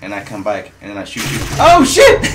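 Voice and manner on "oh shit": sudden loud, high yelp; startled yell. Real, not acted. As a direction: blurt it out in shock, pitch jumping up. Caught out mid-game.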